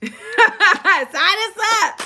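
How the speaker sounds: laughing through it, slowed; amused, laughing sarcasm. Real, not acted. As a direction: giggle out the words.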